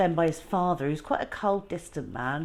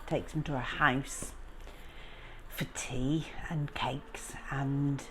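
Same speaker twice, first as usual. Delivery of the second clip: soft storyteller hush; quiet, secretive retelling; hushed recap; 2 semitones lower, 8 dB quieter than usual. A narrator's reading. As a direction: drop to a near whisper.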